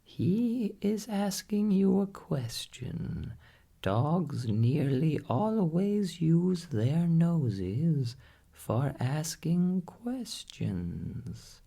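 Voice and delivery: high, squawky parrot voice, slow and patient; a wise parrot setting the doctor straight. A narrator's reading. Parrot correcting the doctor, in a high parrot voice.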